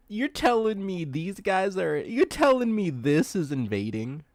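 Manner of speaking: astonished, emphatic protest; loud disbelief. Real, not acted. Can't believe cute stoats are invasive.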